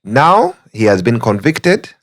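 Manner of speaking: ordinary talk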